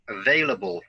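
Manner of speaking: "available" with the stress on VAIL: a-VAIL-a-ble; "available" modelled for repeating, VAIL stressed; model word stress. Shown as a demonstration. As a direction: say "available" clearly and punch the second syllable VAIL. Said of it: "listen and repeat after me".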